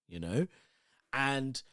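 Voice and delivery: ordinary talk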